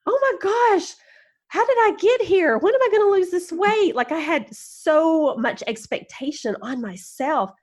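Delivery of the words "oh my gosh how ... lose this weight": high, frantic inner voice; high-pitched mock dismay at the mirror; mock panic. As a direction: squeal it in horror at yourself. Retold self-critical panic at mirror.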